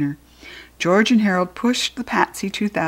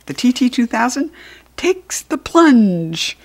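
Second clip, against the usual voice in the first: drawn out; 3 semitones higher than usual. Acted. Dramatic flip-o-rama title.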